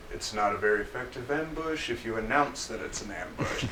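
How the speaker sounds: low, soft, unimpressed aside; dry gentleman. Acted. Gentleman in a suit correcting the bandit, dryly understated. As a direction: undercut him quietly and low.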